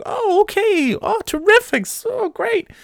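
squeaky high falsetto, overjoyed; cheery Gladys. Retold or imitated. Coworker Gladys gets a dull task, gushing high falsetto. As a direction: chirp it like a relentlessly happy coworker. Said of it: "And she'd be like".

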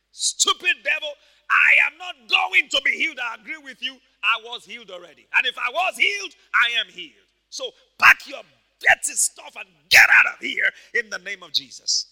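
loud, defiant rebuke of the devil; full-force preaching. Acted. Papa Hagin ordering the devil out, loud defiant preaching. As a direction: drive the devil out with authority.

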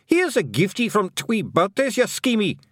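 very high, feisty little man on the attack; high-pitched combative cry; Feegle yell. A narrator's reading. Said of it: "he yelled".

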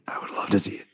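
breathy whispered repeat. Acted. Podcast host whispering his wish to cohosts.

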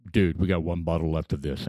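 a hint of a chill store guy; quoting Stan. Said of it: "So Stan says".

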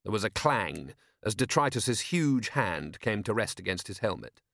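ordinary delivery, nothing marked

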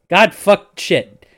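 loud, slow burst of real annoyance; frustrated outburst. Real, not acted. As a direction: blurt it out, fed up with his own slip. GM tangles cabin and cavern.

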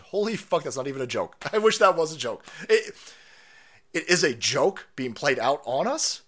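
ordinary talk

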